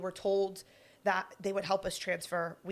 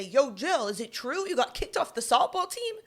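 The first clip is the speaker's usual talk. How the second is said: louder, higher jock voice calling across the store; 6 semitones higher, 6 dB louder than usual. Retold or imitated. Football guys loudly asking Jill about being cut, calling out. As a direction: holler it for everyone to hear. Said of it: "very loudly say".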